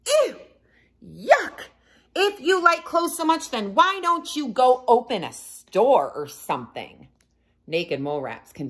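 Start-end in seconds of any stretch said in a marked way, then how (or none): 0.1-7.1 high sneering group voice; scornful, mocking the idea; sarcastic mocking. A narrator's reading. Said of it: "they said".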